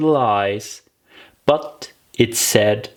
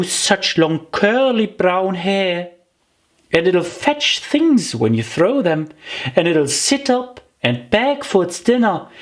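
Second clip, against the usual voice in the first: put-on higher, eager girlish rattling on; eager chatter; 9 semitones higher than usual. Alice chattering to the mouse about the dog, eager and higher. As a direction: gush about the terrier. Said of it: "Alice went on eagerly".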